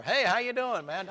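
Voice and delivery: a hint of a cocky runner greeting the king; casual Elijah. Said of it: "I'd be talking like that".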